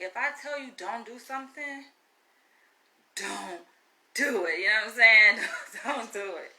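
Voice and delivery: usual speaking voice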